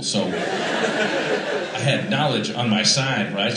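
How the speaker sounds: ordinary talk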